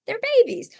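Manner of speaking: high, fast gushing over babies. Retold or imitated. Mother gushing over a newborn, high and fast. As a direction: gush like everyone expects you to.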